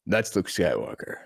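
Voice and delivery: low murmur like Batman. Retold or imitated. Batman introducing Mark Hamill, low deadpan voice.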